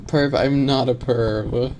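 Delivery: usual speaking voice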